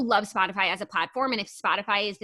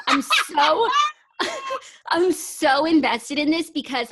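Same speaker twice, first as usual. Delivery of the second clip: emotional laugh; 7 semitones higher, 6 dB louder, slower than usual. Real, not acted. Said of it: "I'm getting emotional".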